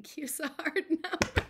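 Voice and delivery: said through real laughter; laughing. Real, not acted. Quilter joking about the Q, laughing through it. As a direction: laugh the words out. Laughing at her own joke.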